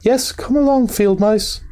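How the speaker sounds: high-pitched eager welcome; Mole, eager. A narrator's reading. Host calling the field mice inside, eager. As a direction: urge them in, high and keen. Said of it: "cried the Mole eagerly".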